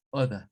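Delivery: non-rhotic order; British order. Shown as a demonstration. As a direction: drop the R in order. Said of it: "ingleses refinados".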